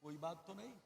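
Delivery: hushed aside. Real, not acted. Preacher quietly tells someone on stage to pocket the note, hushed aside. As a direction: murmur it away from the microphone.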